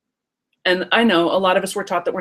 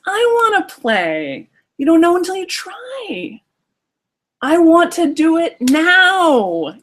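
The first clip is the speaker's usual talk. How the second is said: put-on much higher, slow demanding child; insistent little kid; 9 semitones higher, 4 dB louder, slower than usual. Inner child insisting to the nervous parent, high and slow. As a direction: demand it like an impatient small child. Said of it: "the little kid says".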